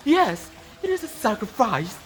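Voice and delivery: plain reading voice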